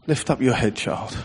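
put-on soft and gentle, dying away on child; hushed reassurance; Father's tender voice. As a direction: speak it over yourself like a loving father. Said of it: "as if it was the Father saying it".

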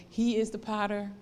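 ordinary delivery

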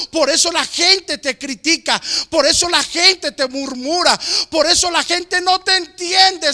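fervent preaching. Acted. Preacher urging believers that they are different, fervent and loud. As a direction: drive it home to the congregation. Preaching builds to full force.

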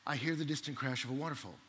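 soft, low anticlimax; sudden hush. Retold or imitated. Hiker hearing a distant waterfall, hushed. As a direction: drop suddenly low and quiet.

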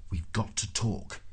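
slow, weighted urgency; urgent. A narrator's reading. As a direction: press it slowly and seriously. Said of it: "he said urgently".

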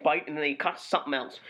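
ordinary talk, nothing marked

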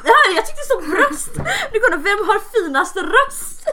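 high, loud and slowed by laughter; laughing. Real, not acted. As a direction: squeal it out through helpless laughter. Laughing over a misheard word.

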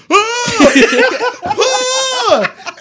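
mock screams. Dad screaming in his sleep.

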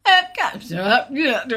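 casual, high, mumbled call to the guys; deliberately sloppy tannoy announcement. Retold or imitated.

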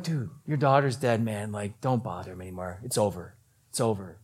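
low, soft, resigned delivery; subdued bad news. Servants telling Jairus not to bother, subdued. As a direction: let the voice sink as hope ends.

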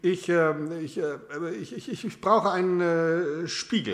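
halting, stumbling over the first word; stuttering. Acted. Nervous customer asking the salesman for a mirror, stuttering. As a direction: stammer it out nervously. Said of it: "Hab ich gestottert".

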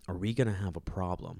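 quiet menace from the dad; dad's whisper. Retold or imitated. The father pulling his son in, a soft low threat. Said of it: "He'd whisper".

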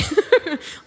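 short real laugh. Real, not acted. Preacher keeps laughing with the congregation. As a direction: let the laugh roll on.

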